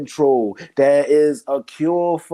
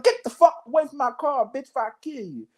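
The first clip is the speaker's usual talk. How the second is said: high-pitched acted tirade, dropping at the end; angry rejection; 7 semitones higher, faster than usual. Rejecting an ex who comes begging, high-pitched mock fury. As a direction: order them off in hammed-up outrage.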